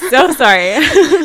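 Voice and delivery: slow, teary laugh through the apology; tearful apology. Real, not acted. Guest apologizing while crying over her husband's touch. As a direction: stretch it out, half crying, half laughing. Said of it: "No, I'm crying too".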